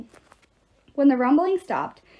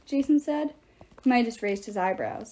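ordinary delivery

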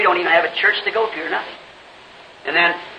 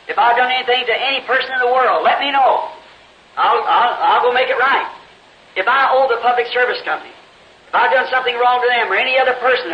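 raised, earnest plea to the Lord; pleading prayer; 4 semitones higher than usual. Retold or imitated. Preacher retelling his prayer, pleading to the Lord. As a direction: beg God to show what he did wrong. Quoted prayer begging God for guidance.

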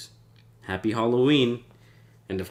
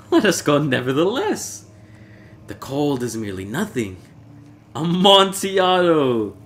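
high, loud tipsy insistence ending in a cry; nothing will stop him now; obsessed Fortunato; 6 semitones higher, 6 dB louder than usual. Acted. Fortunato insists despite cold.

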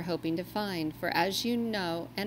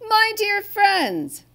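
much higher, slow, louder fatherly greeting; jolly Santa; 14 semitones higher, 9 dB louder, slower than usual. Acted. Santa welcomes the helpful reindeer, slow booming delight.

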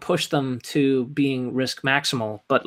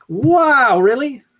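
real high, loud surprise; drawn-out, high-pitched disbelief at what just happened; surprised; 10 semitones higher, 7 dB louder, much slower than usual. Real, not acted. Player reacting to opponent's unexpected move, surprised.

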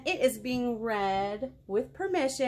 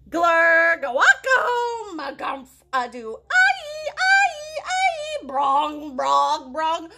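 loud, high, laughing gibberish noises; silly noises; 10 semitones higher, 8 dB louder, slower than usual. Acted. Reader making silly noises the book forces on her, loud gibberish. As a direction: spit out the nonsense sounds with glee. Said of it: "make silly noises".

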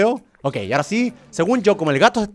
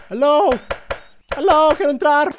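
calling out; 11 semitones higher, slower than usual. Pretend. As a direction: shout to be let in, pleading. Dog calling at the door.

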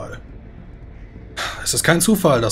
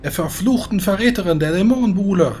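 booming voice over the battlefield; magically amplified voice; 11 semitones higher, 5 dB louder than usual. Acted. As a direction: proclaim it across the whole army. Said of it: "eine magisch verstärkte Stimme klingt".

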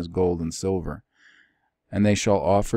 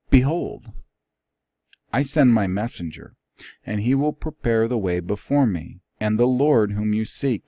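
louder proclaiming reading voice; scripture reading; 4 semitones higher, 5 dB louder than usual. Acted. Teacher reads Malachi three aloud, in a proclaiming voice. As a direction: announce "Behold" like a prophecy.

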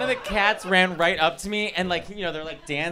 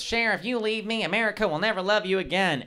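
retold stage yell; 5 semitones higher than usual. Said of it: "[name] was yelling at [name]".

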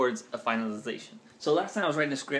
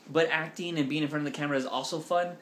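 ordinary talk, nothing marked

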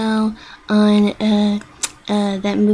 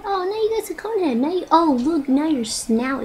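ordinary delivery

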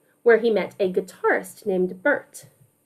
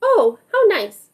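lightly voiced pleased mother, higher; a touch of a delighted mama; mama's voice; 7 semitones higher than usual. Mama admiring Miles's saxophone, lightly voiced and higher. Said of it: "says his mama".

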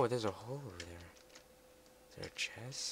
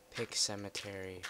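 usual speaking voice

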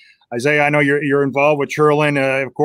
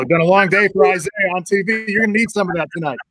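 usual speaking voice

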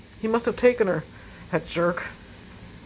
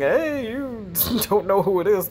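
usual speaking voice

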